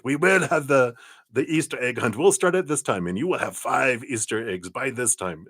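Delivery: high, regimented German accent, hammed up; mock German accent. Psychic voicing a spirit for a viewer in a bad, regimented German accent. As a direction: bark out the schedule like a strict German Easter bunny. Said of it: "very, you know, regimented".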